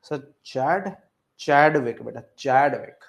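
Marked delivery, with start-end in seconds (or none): none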